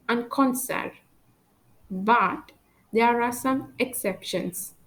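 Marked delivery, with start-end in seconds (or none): 0.1-0.9 French "concert" with the final T silent. Shown as a demonstration. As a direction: end "concert" on the R, no T. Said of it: "I don't pronounce the letters D and T".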